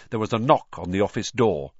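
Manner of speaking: usual speaking voice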